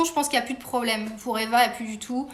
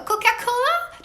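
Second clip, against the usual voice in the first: put-on Coca-Cola? higher and slow, English accent pushed hard; a second Coca-Cola, English accent laid on thick; full English accent; 10 semitones higher, slower than usual. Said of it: "j'essaie de mettre l'accent à fond".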